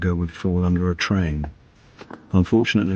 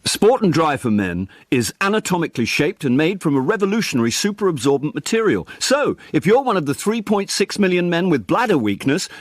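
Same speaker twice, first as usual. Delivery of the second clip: upbeat sporty voiceover, pitched up; energetic ad read; 8 semitones higher, 3 dB louder than usual. Shown as a demonstration. Actor recording an advert for the director. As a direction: sell it with pace and energy. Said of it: "try one with a bit more energy".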